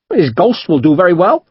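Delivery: high, amused Devonshire captain; wry captain. A narrator's reading. Captain teasing the merchant about the hidden man, Devonshire accent. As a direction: needle him with dry courtesy.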